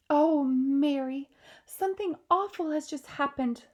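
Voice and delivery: a shaken queen telling her maid; the queen, upset. Acted. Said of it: "a voice Sophie had heard many times".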